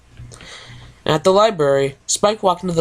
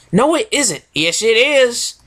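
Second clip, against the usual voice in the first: petty bickering; 8 semitones higher, slower than usual. Acted. Acted quarrel of two ponies.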